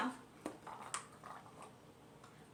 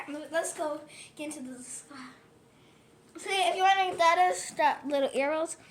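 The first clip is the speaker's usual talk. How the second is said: ordinary talk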